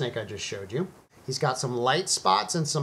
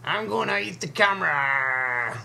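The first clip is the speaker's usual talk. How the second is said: high, louder little snake bragging; 7 semitones higher, 3 dB louder than usual. Pretend. Vlogger voicing the milk snake boasting at the camera. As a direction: puff up like a tiny fierce snake. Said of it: "The snake says".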